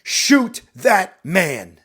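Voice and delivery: loud, slow, word-by-word command; glaring shout. Acted. Captain glaring at the hesitant corporal, shouting slowly. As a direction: hammer each word of the order. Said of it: "he's glaring at you and he shouts".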